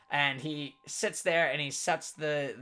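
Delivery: usual speaking voice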